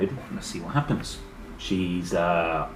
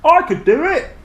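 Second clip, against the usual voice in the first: put-on high, loud boyish offer; shouted; 10 dB louder than usual. Jack shouts his offer to the teacher, high and loud. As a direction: shout out bravely. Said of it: "Jack shouted".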